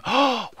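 sharp intake of breath; sudden amazed gasp; excited gasp. Real, not acted. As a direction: gasp in delighted surprise. Discovers the turtle can be named.

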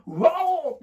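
high wail of shock; a half-joking cry of dismay; mock dismay. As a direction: act out realising you forgot. Recalling the shock of forgetting.

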